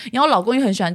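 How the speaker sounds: ordinary talk, nothing marked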